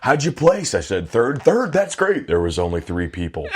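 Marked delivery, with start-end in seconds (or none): 0.0-0.7 high, louder eager question; dad's cheery friend. Retold or imitated. Dad's friend asking the kid's result, high and eager. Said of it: "one of my dad's friends is like". 1.4-2.3 high, loud, over-impressed reaction; dad's impressed friend. Retold or imitated. Dad's friend hearing the kid's placing, excited praise. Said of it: "one of my dad's friends is like".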